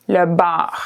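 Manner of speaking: le bar with a French R at the back of the throat. Shown as a demonstration. Teacher showing the French R in le bar. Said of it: "That French R".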